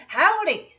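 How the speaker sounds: high, loud cartoon Texan hello; hammed-up Texan hail; mock Texan greeting. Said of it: "That's how they say hi in Texas".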